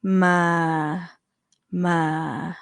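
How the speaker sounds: "Ma" low in a male pitch; deep low tone "Ma". Shown as a demonstration. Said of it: "male's pitch".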